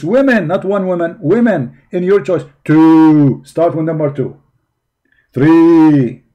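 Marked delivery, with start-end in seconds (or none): 2.6-3.4 "two" loud and drawn out; stress on two. Real, not acted. Hammers numbers from the verse. 5.3-6.2 "three" loud and drawn out; stress on three. Real, not acted. Hammers numbers from the verse.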